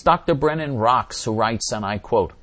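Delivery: ordinary delivery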